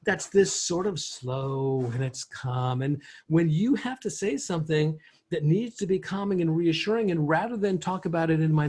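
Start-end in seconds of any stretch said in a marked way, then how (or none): 0.0-2.8 drawn-out, unhurried DJ delivery; slow and calm. Shown as a demonstration. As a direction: stretch it out slowly and calmly. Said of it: "You know that voice?".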